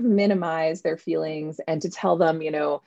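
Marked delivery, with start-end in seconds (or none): none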